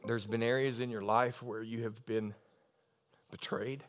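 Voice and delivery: low, soft, halting counsel; hushed and compassionate; tender personal ministry. Real, not acted. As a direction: speak gently to a hurting woman, pausing. Ministers gently to one woman.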